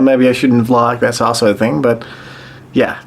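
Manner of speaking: ordinary talk, nothing marked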